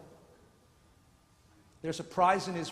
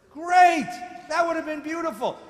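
mock delight; 9 semitones higher, 10 dB louder than usual. Sarcastic cheer at the choice.

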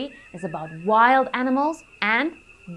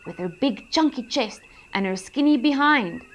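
usual speaking voice

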